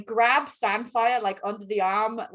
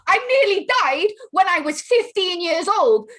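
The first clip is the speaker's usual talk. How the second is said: raised, loud in-character outburst; angry accusation; 6 semitones higher, 5 dB louder than usual. Acted. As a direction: hurl the blame in a heated quarrel. Acted quarrel between two characters.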